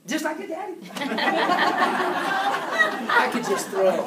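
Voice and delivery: laughing loudly through the words; real laughter, words bubbling out between laughs. Real, not acted. Speaker laughing at a joke about his own catching.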